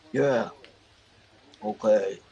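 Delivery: a hint of Michael's offhand reply; imitating brother. Mimicking how her brother answered her praise, lightly voiced. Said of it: "Kinda like that".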